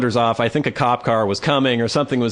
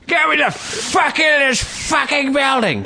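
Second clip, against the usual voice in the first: high, slow mock scream of a raving homeless man; 14 semitones higher, slower than usual. Homeless man screaming up at the building to be let in. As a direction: belt it out like a furious man on the street. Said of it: "Just screaming at the top of his lungs".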